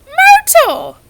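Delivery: huge high bellow across the room. A narrator's reading. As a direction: bellow her name at full volume.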